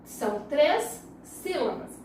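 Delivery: usual speaking voice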